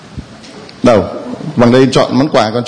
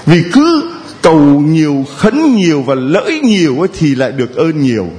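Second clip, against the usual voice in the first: normal talking voice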